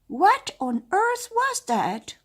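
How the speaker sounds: very high, slow, loud witch question; startled witch. Acted. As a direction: ask it startled and shrill.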